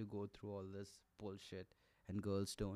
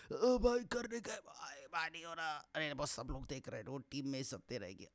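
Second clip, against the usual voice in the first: struggling guy; 3 semitones higher, faster than usual. Retold or imitated. A guy mid push-up begging his friend to keep going, strained and pleading. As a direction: beg your buddy through the strain.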